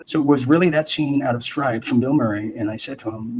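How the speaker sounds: usual speaking voice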